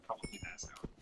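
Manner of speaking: a breathless little whisper of an aside. Real, not acted. Whispering to viewers about fainting at her wedding, hushed aside. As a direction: drop to a secret whisper for the punchline.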